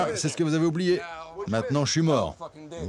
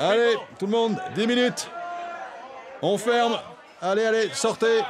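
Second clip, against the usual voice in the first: raised voice, herding people out; calling out to crowd; 8 semitones higher than usual. Real, not acted. Closing bar, clearing out customers. (appel fort à la foule)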